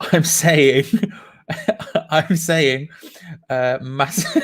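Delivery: giggling, can't get it out; laughing. Real, not acted. Host cracking up over his sixty-nine pick. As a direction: break up laughing before the punchline. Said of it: "you'll see why I'm laughing".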